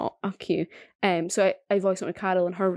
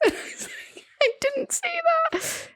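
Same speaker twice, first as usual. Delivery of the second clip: breaking into high laughter mid protest; giggling protest; laughing; much slower than usual. Retold or imitated. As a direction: laugh it out, pitched up. Recalling the obese fingers row.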